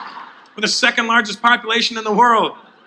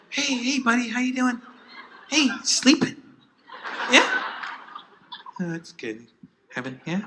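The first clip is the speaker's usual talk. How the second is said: hushed, much softer coaxing voice; soft sweet-talk to sleeping kid; 3 semitones higher, 9 dB quieter than usual. Pretend. Parent easing into a sleeping kid's room, hushed and sweet. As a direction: gently lull the child before the whack.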